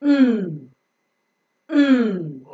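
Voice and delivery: "mm" as a long nasal nnn; phonic N sound. Shown as a demonstration. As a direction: hum "Mm" as N, tongue tip on the teeth ridge. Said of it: "N has got the phonic sound of".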